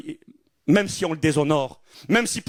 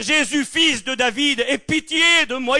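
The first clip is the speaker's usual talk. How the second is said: high, slow cry for mercy; 9 semitones higher, slower than usual. Retold or imitated. Blind beggar Bartimée crying out to Jesus, high and slow. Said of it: "Il va crier. Il va dire".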